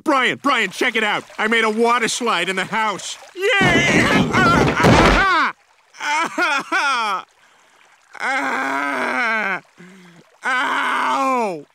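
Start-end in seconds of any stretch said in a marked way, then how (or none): 3.3-5.5 high gleeful yay and laugh; excited squeal. Acted. Kid on a water slide. 6.0-11.7 high repeated ah cries ending in ow; yelps of pain. Acted. Sliding down then crashing.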